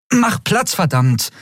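high impatient shout. A narrator's reading. Endriel pushing Keru aside to reach the screen. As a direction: shove past, annoyed.

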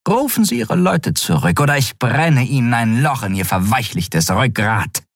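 high, icy threat; ice cold. Acted. Shiar threatens the admiral in an ice-cold tone. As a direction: turn cold and menacing. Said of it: "ihr Ton kalt wie Eis".

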